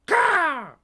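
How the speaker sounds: high, loud raven squawk; sharp bird call; raven caw. Acted. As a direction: caw like a crow. Raven Anna caws.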